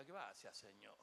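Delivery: low, hushed aside of thanks; quiet imagined thanks. Retold or imitated. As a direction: toss off a small, soft thank-you. Voices what ungrateful people never say. (agradecimiento en voz baja)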